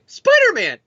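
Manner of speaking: high, chirpy eager reply; squeaky high-pitched Spider-Man!; eager Dan answer. Acted. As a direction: pipe up like a kid begging for his favourite toy. Dan Slott voiced answering editor.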